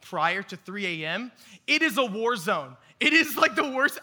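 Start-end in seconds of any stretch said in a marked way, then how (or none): 1.7-4.0 rising exasperated exclamation, louder and higher; a worked-up rant about the airport. Real, not acted. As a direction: build up the outrage. Ranting about chaotic airport.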